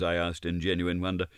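ordinary delivery, nothing marked

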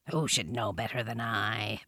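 low, soft panther voice; low, sardonic panther murmur; Bagheera the panther, wry. A narrator's reading. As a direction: answer low and quiet, like a big cat.

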